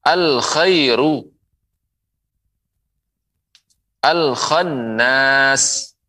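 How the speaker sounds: very slow, drawn-out "al-khannas"; slow model reading. Shown as a demonstration. Teacher modelling "al-khairu" slowly to viewers. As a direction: sound out "al-khairu" for students.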